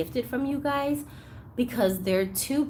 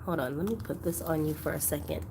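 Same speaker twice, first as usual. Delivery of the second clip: usual speaking voice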